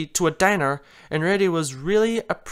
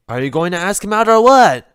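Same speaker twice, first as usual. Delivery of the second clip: louder, exasperated demand; 3 semitones higher, 9 dB louder than usual. Acted. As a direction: push her, fed up. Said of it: "Twilight asked in exasperation".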